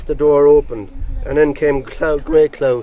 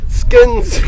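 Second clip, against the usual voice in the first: shrill-high jeering shout of the word; mock the man with a high taunting cry. Crowd jeering at Grey Clothes, high mocking cry. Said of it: "they cried, mocking him".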